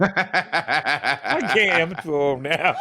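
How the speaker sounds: talking through laughter; real laughing. Real, not acted. Caught in his old Jamaican story, laughing hard. Cracking up at own old lie.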